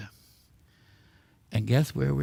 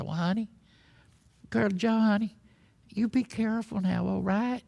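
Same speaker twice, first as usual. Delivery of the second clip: anxious prairie wife, pitched up; Texan wife character; 7 semitones higher than usual. Acted. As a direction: fret gently at your cowboy husband in Texan. Voices worried wife in put-on accent.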